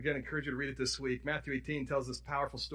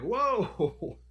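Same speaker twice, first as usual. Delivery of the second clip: a raised, startled whoa; mock surprise; 5 semitones higher, 4 dB louder than usual. Preacher recalling his shock at Jesus' words, surprised whoa. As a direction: gasp it out as if stunned. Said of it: "I'm like".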